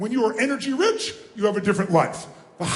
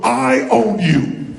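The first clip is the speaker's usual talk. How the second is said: loud, drawn-out declaration; firm, slow challenge thrown loudly; commanding demo; 6 dB louder, much slower than usual. Shown as a demonstration. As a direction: stretch it out and project it at one person. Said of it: "point at them and go".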